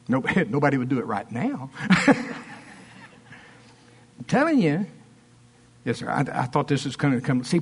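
usual speaking voice

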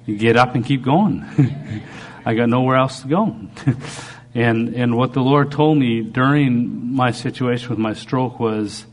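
usual speaking voice

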